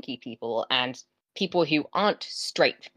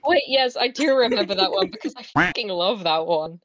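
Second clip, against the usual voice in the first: excited, louder and higher; 5 semitones higher, 4 dB louder than usual. Real, not acted. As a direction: burst in with delight. Excited recognising the meme.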